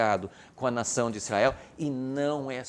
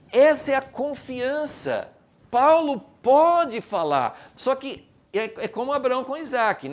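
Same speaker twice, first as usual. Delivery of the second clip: loud, high, emphatic proclamation; forceful sermon voice; impassioned preaching; 12 semitones higher, 8 dB louder than usual. Real, not acted. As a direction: proclaim it boldly to the congregation.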